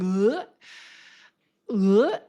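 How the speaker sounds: loud guttural retch of revulsion; hammed-up gagging groan; disgusted "ugh". Seeing him and mom look like dating, revolted groan.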